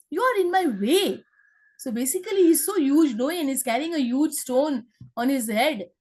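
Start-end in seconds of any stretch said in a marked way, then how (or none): 0.1-1.2 high, mock rude shout from a big monkey. Monkey scolding the squirrel in his way, high mock shout. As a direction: bark at the little squirrel like a rude brute. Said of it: "shouted the monkey".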